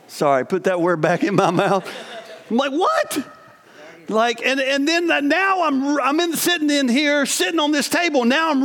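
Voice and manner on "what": shocked what. Retold or imitated. Shocked reaction.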